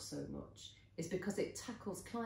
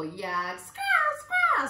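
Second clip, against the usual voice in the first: very high, loud parrot squawk; squawking macaw; 13 dB louder, much slower than usual. A narrator's reading. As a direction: screech like a macaw. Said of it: "squawked another".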